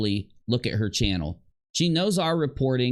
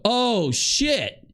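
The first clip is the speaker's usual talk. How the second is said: high mock "Oh, shit" in alarm; imitating a shocked outburst; 10 semitones higher than usual. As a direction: act out her startled realisation. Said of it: "made her literally ... go".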